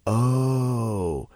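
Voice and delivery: one steady, drawn-out "ohhh" tone; long held "oh". Retold or imitated. Dreamer droning at a mirror image, long held oh. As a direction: hold out the oh like a droning vibration. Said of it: "holding out that oh sound".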